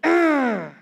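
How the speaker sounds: imitated growl. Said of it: "stop growling".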